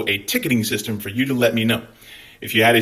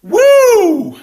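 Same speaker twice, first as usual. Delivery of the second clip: whoop; 9 dB louder than usual. Real, not acted. Cheer at the sign-off.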